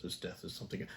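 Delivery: whisper. Real, not acted. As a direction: breathe it like a secret. Repeats remark, then whispers it.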